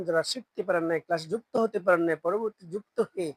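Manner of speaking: ordinary talk, nothing marked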